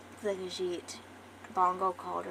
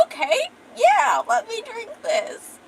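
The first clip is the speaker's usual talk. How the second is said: much higher, slow and loud goofy eagerness; silly dude; 12 semitones higher, 10 dB louder, slower than usual. Acted. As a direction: play the happy-go-lucky fool agreeing to anything. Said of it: "he's just a little silly".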